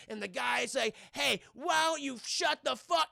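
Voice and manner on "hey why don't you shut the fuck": loud-mouthed heckler, slow and high; acted angry comeback, drawn out; rude retort. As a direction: snap back like a rude moviegoer. Rude moviegoer snaps back.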